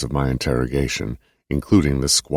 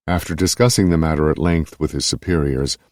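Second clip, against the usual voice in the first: ordinary delivery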